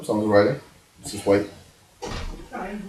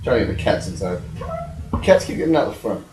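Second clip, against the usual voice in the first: usual speaking voice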